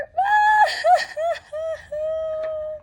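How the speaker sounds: high hammed-up sob; mock sobbing wail. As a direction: let out a big pretend boo-hoo. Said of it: "Mister Bird began to cry".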